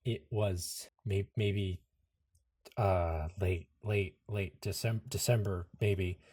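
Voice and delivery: soft, low, halting search for the answer; faltering recall. Acted. Anderson answering the villain, unable to recall his birthday, faltering. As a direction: stammer as the memory slips away.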